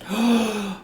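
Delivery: surprised gasp. Acted. Chrissy reacts in character.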